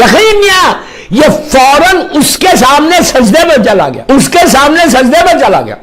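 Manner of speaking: fervent shouted climax; ecstatic shout. Real, not acted. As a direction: burst out at the miracle. Climax of the miracle story.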